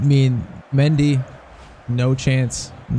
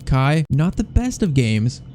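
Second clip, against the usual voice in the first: ordinary delivery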